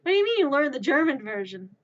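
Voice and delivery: loud, quick incredulous question; baffled challenge. Acted. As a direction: demand an explanation in disbelief. Confused questioner challenges the speaker.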